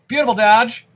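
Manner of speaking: loud, high exclamation of delight; excited cheer. Real, not acted. Cheering a dodge in game.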